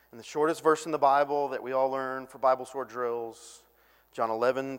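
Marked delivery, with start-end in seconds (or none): none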